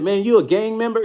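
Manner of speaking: high disbelieving question; can't believe what he heard; surprised. Retold or imitated. Asking his old sparring friend, high and disbelieving.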